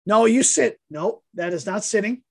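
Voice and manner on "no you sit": mock scolding.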